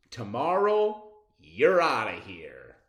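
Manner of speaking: playful loud warning; mock threat. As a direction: tell the fish off with a grin. Kid threatens fish.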